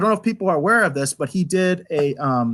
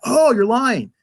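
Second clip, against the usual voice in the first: higher, mock-naive interjection; imitated comic relief character; 6 semitones higher, slower than usual. Reviewer mimics Elnor popping into the scene, mock interjection. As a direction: pop in with a pitched-up comic accusation. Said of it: "just kinda pops in and is like".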